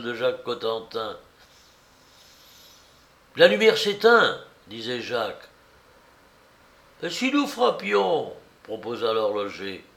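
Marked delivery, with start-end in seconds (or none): 3.4-4.4 raised, louder cry of alarm; urgent exclamation. A narrator's reading. Jacques to his companions at the door, urgent exclamation. Tense search outside the door. 7.0-8.3 high-pitched urgent proposal; eager suggestion. A narrator's reading. Watchmaker to his fellow searchers, eager suggestion. Horloger proposes knocking, voiced.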